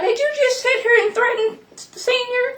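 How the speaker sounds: very high, laughing disbelief; shocked disbelief. Real, not acted. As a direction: squeal it out in amused shock. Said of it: "that's what it sounded like to me".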